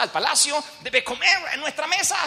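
high-pitched, jubilant royal command; excited king. Acted. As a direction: call out the invitation with rising excitement. King orders heir brought home. (rey entusiasmado, voz muy aguda)

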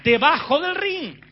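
"debajo del ring" lento, más agudo y fuerte, marcando "del"; énfasis enfático. Real, not acted. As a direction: remarca "debajo del ring" despacio y con fuerza. ("debajo del ring" dicho lento y fuerte, marcando "del")

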